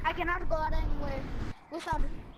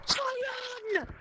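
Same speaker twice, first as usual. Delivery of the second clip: much higher, shouted name of the colour; excited shout; 8 semitones higher than usual. Real, not acted. Spotting his friend's colour, excited shout. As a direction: burst it out like a discovery.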